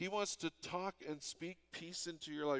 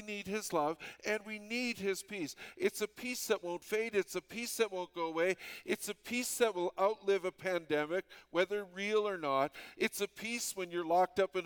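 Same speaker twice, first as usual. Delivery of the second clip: louder, rising preacher repetition; preaching build; 4 semitones higher, 5 dB louder than usual. Acted. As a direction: drive each line harder.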